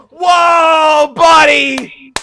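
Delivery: loud high whoa shout. Real, not acted. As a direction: yell it out in alarm. Loud exclamation reaction.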